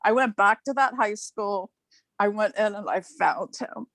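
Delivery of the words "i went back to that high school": ordinary talk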